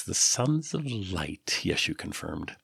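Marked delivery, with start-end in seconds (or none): 0.0-1.6 the Sons of Light drawn out very slowly, softer; slowly recalling a known group; slow knowing echo. Acted. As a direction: savour the Sons of Light, recognising the name. Yeshu repeats the name knowingly.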